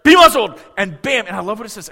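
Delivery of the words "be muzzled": loud, high, sharp rebuke; annoyed command. Acted. Jesus rebuking the storm, loud annoyed command. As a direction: snap it at the storm. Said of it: "imagine him being a little annoyed".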